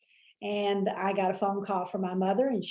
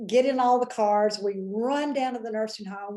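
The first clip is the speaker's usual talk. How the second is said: ordinary delivery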